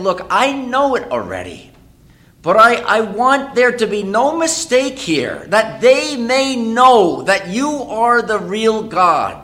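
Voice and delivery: loud, high, slow pleading prayer; fervent preached prayer. Acted. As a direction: cry out to God so all can hear. Voicing Elijah's plea.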